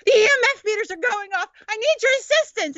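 high, fast fake alarm; mock urgent summons. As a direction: act urgent so he can escape the groupies. Staged rescue call.

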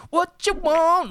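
high, drawn-out yelp; hammed-up high-pitched challenge; mock cop shout. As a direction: imitate a rowdy yell from Cops. Mimics cops in trailer scene.

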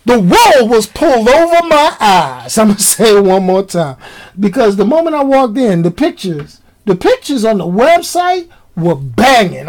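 ordinary talk, nothing marked

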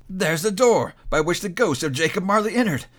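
high, eager discovery; excited cry. A narrator's reading. Scrooge frisking round the fireplace, excited cry. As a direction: exclaim over each new proof. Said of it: "cried Scrooge".

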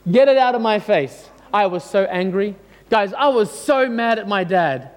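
ordinary talk

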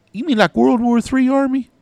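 high, loud mimicked kid; twelve-year-old kid. Kid hearing about a son in the Army, high loud mimicry. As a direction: blurt it out like an amazed kid. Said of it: "And then he goes".

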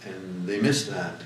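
ordinary delivery

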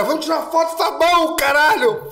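put-on loud high drunken yell; excited fan shout. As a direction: shout for a photo. (grito animado de fã)

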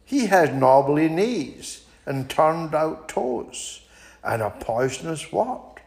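high, polite little mouse turning down an invitation; small and prim, declining sweetly; a high, prim mouse fibbing to the owl. Acted. Mouse describes gruffalo.

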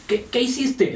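high, accusing mock question to the dog; scolding owner. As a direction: imitate a typical owner scolding, pitch up. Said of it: "generalmente dicen".

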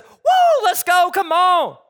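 high loud whoop, slow pumped-up cheer; mock hype. Zechariah imagined as wildly excited, loud whooping cheer. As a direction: cheer like a fan at a game. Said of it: "Zechariah says".

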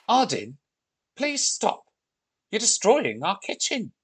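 high, urgent pleading; alarmed plea. Acted. Olivia begging the Viking wrecking the kitchen, alarmed. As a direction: beg the wrecker to stop.

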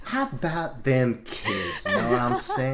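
slow Southern drawl, laughing; Southern accent. Retold or imitated. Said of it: "that's more southern than anything".

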